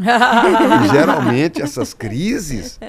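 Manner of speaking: laughing. Real, not acted. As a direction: laugh your way through it. (rindo alto, devagar)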